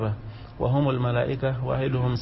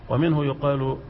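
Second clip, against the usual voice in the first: normal talking voice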